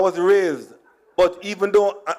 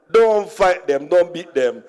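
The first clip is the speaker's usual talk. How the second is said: ordinary delivery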